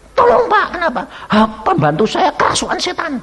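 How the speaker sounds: high panicked begging with a laugh; plead, panicked, voice up high; frantic plea. Retold or imitated. (tetangga panik minta tolong, suara tinggi)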